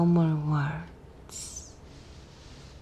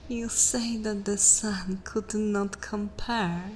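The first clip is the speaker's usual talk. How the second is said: ordinary talk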